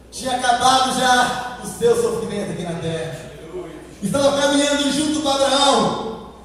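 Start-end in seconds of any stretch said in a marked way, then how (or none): 4.0-6.3 loud, mounting sermon delivery; impassioned preaching. Real, not acted. Preacher building up to the voice in paradise, full force. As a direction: build phrase by phrase towards a great call.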